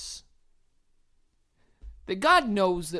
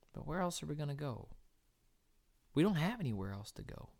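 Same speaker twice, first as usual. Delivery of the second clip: soft closing exhortation; 7 semitones lower, 9 dB quieter than usual. Real, not acted.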